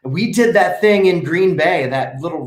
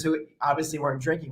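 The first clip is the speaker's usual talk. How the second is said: ordinary talk, nothing marked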